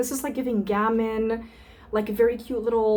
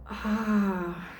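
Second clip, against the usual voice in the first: long, falling "ahh" of pleasure; pleased sigh; 3 dB quieter than usual. Real, not acted. Savouring a perfume sample, pleased sigh. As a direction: let out a drawn-out contented ahh.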